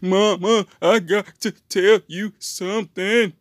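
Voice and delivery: put-on high, loud, slow little-boy plea; little boy calling his mama, high and loud; loud high whiny child. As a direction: whine it like a kid with big news for mama. Boy voiced to mother.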